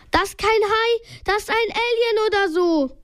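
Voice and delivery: high-pitched alarmed character voice; a contained yell, pitched well up. A narrator's reading. Peter warning friends about the creature, yelling. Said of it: "brüllte Peter".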